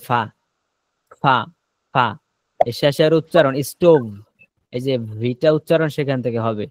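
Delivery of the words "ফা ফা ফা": ফা with the lip-and-teeth f of Arabic; Arabic fa sound. Shown as a demonstration. Teacher demonstrating Arabic fa to show where v is made. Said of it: "আরবি ফাটা উচ্চারণ হয় কোত্থেকে?".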